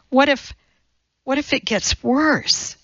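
high, faltering restart; desperate. A narrator's reading. Young mother fearing it gets worse, desperate. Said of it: "Elizabeth said desperately".

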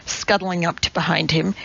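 ordinary delivery

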